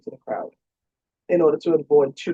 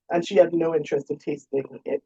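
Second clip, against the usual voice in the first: plain reading voice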